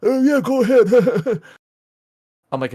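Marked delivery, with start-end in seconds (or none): 0.0-1.4 high, slow mocking wave-through; mock polite driver. Mocking the waving driver at the intersection, high and slow. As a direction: mimic the other driver, high and drawn out. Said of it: "And they're waving".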